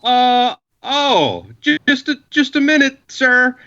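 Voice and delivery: high, stammering, scared to death; nervous stammer. Retold or imitated. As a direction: fumble the words in a high panicky voice. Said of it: "he's all like".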